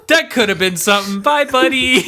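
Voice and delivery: high, laughing send-off; hammed-up wistful goodbye through laughter; mock fond farewell. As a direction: call a playful goodbye to a dead joke, voice lifting. Waving goodbye to a failed joke.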